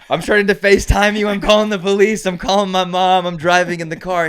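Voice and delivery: mock panic, rattling off every reaction at once; panicked overreaction. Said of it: "Me, I'd be like".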